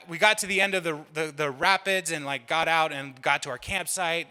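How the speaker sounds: ordinary delivery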